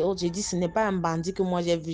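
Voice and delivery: ordinary delivery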